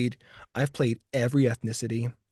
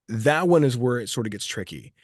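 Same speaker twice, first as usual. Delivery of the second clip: usual speaking voice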